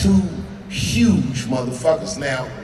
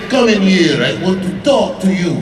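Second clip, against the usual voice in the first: louder, firm summons; old man summoning; 4 semitones higher, 7 dB louder than usual. Retold or imitated. Russian boss calling the comic into the kitchen, firm and loud. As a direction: call him in, commanding. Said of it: "that old man said".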